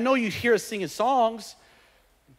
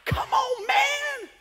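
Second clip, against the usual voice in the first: much higher, exasperated; exasperated come on; much slower than usual. Retold or imitated. God exasperated with believer, high pitched come on man. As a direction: squeal it in disbelief. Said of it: "You got, like, angry".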